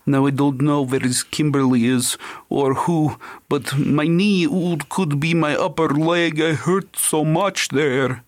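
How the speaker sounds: a light put-on Slavic accent; mock Slavic accent. Psychic channeling for police, mock Slavic accent. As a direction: imitate the psychic's Slavic accent, half-hearted. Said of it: "in a Slavic accent sometimes".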